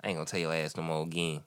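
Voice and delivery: pimp voice. Acted. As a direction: talk down low like a smooth pimp. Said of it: "my pimp voice?".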